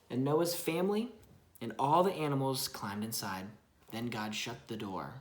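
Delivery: storybook narration for a little one; reading to a child. Acted. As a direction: read it gently to your child. Parent reads Bible story aloud.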